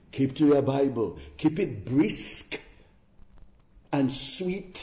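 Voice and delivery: ordinary delivery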